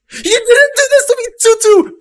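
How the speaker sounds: very high, loud celebration yell; ecstatic scream. Real, not acted. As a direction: shriek with joy. Goal stands, celebrating.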